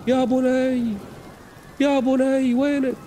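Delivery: high, desperate father calling his son. Acted. Noah searching the ship, calling his son desperately.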